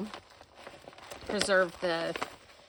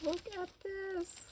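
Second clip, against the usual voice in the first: high, soft, slow wonder; hushed, high-pitched amazement; awed; 9 semitones higher, 9 dB quieter than usual. Real, not acted. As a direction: marvel softly in a high voice. Awed at another item in gift.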